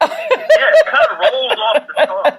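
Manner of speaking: high, slow, laughing the words out; amused and laughing at himself. Real, not acted. Guest laughing as the host praises his handle.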